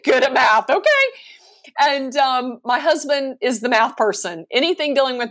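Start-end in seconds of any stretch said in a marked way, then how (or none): 0.0-1.1 laughing through the confession. Real, not acted. Guest admitting to the host she is bad at math, laughing. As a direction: chuckle while admitting it.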